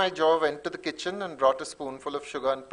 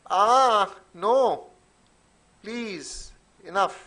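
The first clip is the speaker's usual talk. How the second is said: high, drawn moans falling away in volume; Ma's overheard cries; 5 semitones higher, much slower than usual.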